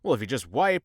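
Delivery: ordinary delivery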